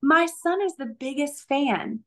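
high, delighted gush over the Red Sox stuff; excited neighbor. Retold or imitated. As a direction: mimic a thrilled mom spotting a treasure. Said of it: "she said".

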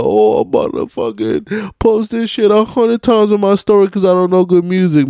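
high, fast mocking voice of excited girls; mocking female fans. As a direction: ape the fans in a silly high voice. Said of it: "females are gonna go crazy, like".

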